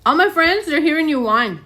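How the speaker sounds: high, loud scolding pet talk; talking to the dog. Acted. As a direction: scold the crying pup like a parent.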